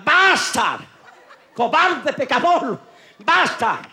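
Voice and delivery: hammed-up indignant cry, high and loud; a comic bar patron shrieking at the boy to stop; mock outrage. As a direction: bellow it like an offended diva. Angry customer in bar.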